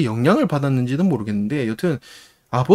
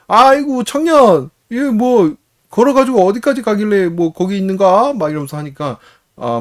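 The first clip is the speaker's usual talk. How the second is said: put-on higher and louder, hearty old countryman calling out; jovial old farmer; 4 semitones higher, 3 dB louder than usual. Old farmer on a tractor urging the stranger to hop on, hearty call. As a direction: hail the young man cheerfully from the tractor.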